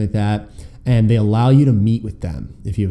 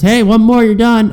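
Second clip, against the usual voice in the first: much higher, louder mock umpire call; hammed-up umpire shouting one more; imitating the umpire; 11 semitones higher, 7 dB louder than usual. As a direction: bark it like an umpire ending warm-ups. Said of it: "umpire says".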